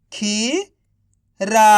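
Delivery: खीरा stretched very slowly, sound by sound; slow blending of खीरा; drawn out. Shown as a demonstration. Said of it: "तो बताइए मिलके क्या बनेगा".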